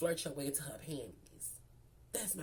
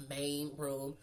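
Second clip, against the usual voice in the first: ordinary delivery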